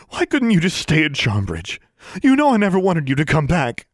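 high, loud, harsh outburst; angry rejection. A narrator's reading. Boggart as mother rejecting daughter, angry high outburst. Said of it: "Those had been the Boggart's words".

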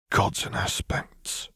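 breathless slow whisper. A narrator's reading. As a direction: barely breathe the words in shock. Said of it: "Counselor Medarda whispered".

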